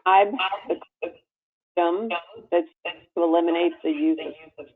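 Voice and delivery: normal talking voice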